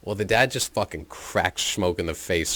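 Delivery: normal talking voice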